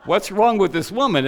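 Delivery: lightly voiced crowd muttering, amused; mocking onlookers. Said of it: "everybody ... going like this".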